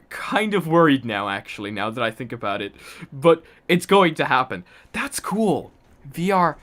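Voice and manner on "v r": ordinary talk, nothing marked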